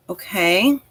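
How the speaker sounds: ordinary talk, nothing marked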